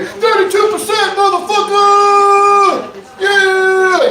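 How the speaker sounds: high, drawn-out rap hype-man shout; backing someone up at full volume; emphatic hype man. Acted. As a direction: holler it out to hype the crowd, stretching the last words. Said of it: "ready to emphatically back you up".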